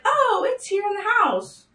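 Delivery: high, slow, airy mom impression; mocking mom. Imitating mom on the phone, high and slow. Mom's careless reply quoted.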